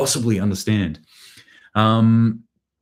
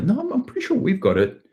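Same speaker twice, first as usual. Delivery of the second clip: squeaky high bad Ukrainian accent. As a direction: insist in a silly, much higher Ukrainian accent. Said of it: "I apologize about my shitty accents".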